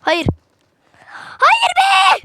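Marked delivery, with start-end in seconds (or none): 1.2-2.2 sudden high yell of frustration; frustrated shout. Real, not acted. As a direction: scream it out as the fight is lost. Oyunda ölünce bağırıyor. (sinirli bağırış)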